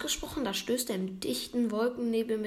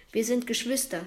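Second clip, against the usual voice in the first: usual speaking voice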